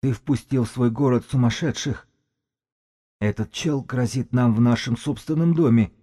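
high, strained hoarse complaint; hoarse priest. A narrator's reading. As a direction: rasp it out high, as an outraged priest. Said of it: "прохрипел жрец".

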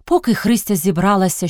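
usual speaking voice

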